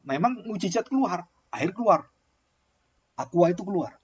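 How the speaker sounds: normal talking voice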